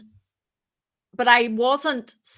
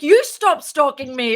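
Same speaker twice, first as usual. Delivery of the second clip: louder, higher comeback flung right back; mock retort; 4 semitones higher, 6 dB louder than usual. The co-founder firing back at the speaker, louder mock retort. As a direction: throw the accusation back at her, playfully. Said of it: "And she's like".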